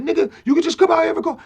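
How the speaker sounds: fast, high, loud imitation; impatient urging. Rapper pressing friend to just come out, impatient urging.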